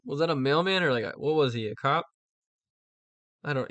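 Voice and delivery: ordinary talk, nothing marked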